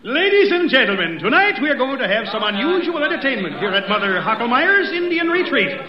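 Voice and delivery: ordinary delivery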